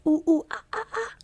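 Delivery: high mock monkey hoots, ooh ooh ah ah; high imitated monkey chatter; toy monkey noise. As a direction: hoot like a wind-up toy monkey. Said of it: "the noise just goes off".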